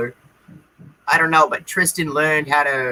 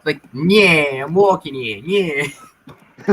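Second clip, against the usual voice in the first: put-on Brooklyn accent; much slower than usual. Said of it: "imagining Joey Wheeler ... from Brooklyn".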